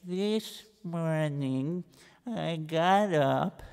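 soft, slow, dragging account; slow plodding recital. Acted. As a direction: drag out every boring detail. Reb Israel plods again.

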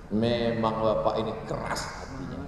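normal talking voice

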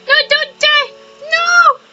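overplayed grief over a dying friend; mock anguish. Companion dies in game.

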